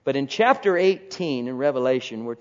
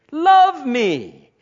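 God pleading, higher; 11 semitones higher, 10 dB louder than usual. Retold or imitated. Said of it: "God's voice pleading, higher".

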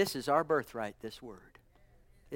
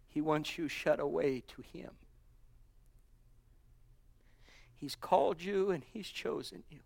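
soft reassurance; 4 dB quieter than usual. Acted. Soft closing to the church.

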